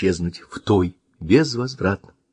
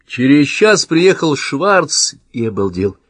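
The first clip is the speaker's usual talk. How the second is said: usual speaking voice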